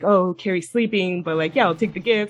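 ordinary talk, nothing marked

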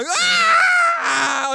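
loud high mock scream of riders; whole car screaming on the drop. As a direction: let out a big high-pitched ride scream. Ride drops, everyone screams.

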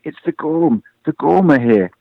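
high, mildly projected cry of alarm; panicked shout. A narrator's reading. Frightened rabbit warning the crowd of the Gorm, panicked cry. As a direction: raise the alarm, pitch lifted. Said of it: "This last shout caused mass panic".

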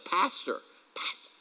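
ordinary talk

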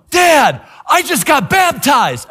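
put-on high, loud kid yelling the news; child shouting happy news; excited kid shout. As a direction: burst in like a thrilled little boy. Little boy running in excited.

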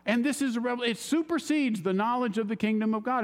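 a fellow raising his pitch with eager excitement; excited fellow. Retold or imitated. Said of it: "this guy was just all excited".

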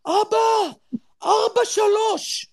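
high, drawn-out mock shriek; imitated screech. Son yells the score to dad from the shower, high retold screech. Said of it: "ומתוך המים הוא צווח".

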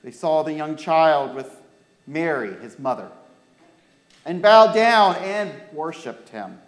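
put-on clear, lively storytelling for children; story reading. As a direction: read the Christmas story to the kids. Reads Bible story to children.

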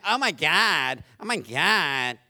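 lightly mocking oh my God, drawn out on the second one; mock exclamation. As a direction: imitate someone carelessly exclaiming. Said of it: "walking around saying".

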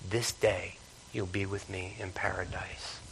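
hushed, slow whisper of "paradise"; Jesus whispering to the thief on the cross; whispered promise. Acted. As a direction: breathe it to the dying thief.

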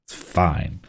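low, soft, defeated "It's fine"; resigned mutter. Real, not acted. Repeats it after bad damage rolls.

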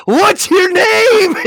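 very high, loud, drawn-out holler; shouted question. Retold or imitated. Downed pilot calls to his falling enemy, hollering. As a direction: yell it slowly to someone far off. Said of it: "in Russian, he says".

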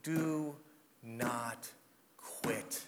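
Do, not, quit, each word separate and fading to a whisper; slow emphatic. Real, not acted. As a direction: space the words out, soft and firm. Final exhortation.

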